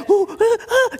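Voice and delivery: high, mock rapturous oohs and ahs; mocking a worship show that thrills people; mock ecstatic moans. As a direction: ham up the swooning worshipper. Mocking a hyped worship environment.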